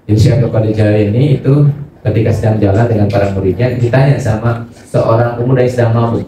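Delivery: normal talking voice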